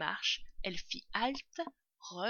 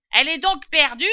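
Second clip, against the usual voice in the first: high, very loud question; calling up; 8 semitones higher, 17 dB louder than usual. A narrator's reading. Servant asking about the lost tail, calling up. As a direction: holler the question upstairs.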